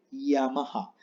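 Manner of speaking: "Yamaha" as slow ia-ma-ja; soft Japanese Y. Shown as a demonstration. Teacher reads the famous brand, "Yamaha" softly as ia-maja. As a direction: open "Yamaha" with an i glide, h breathed like jota.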